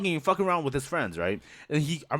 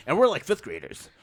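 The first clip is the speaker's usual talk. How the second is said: ordinary talk, nothing marked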